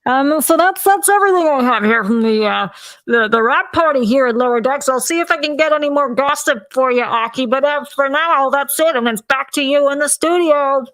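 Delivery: roving party reporter. Pretend. Party reporter signs off to Aki in the studio, playful reporter voice. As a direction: hand it back to the studio from the party.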